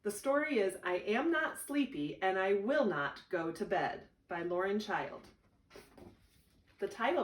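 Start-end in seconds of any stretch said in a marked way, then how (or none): none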